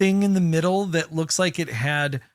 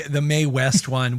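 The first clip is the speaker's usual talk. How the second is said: ordinary delivery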